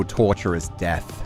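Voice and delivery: ordinary talk, nothing marked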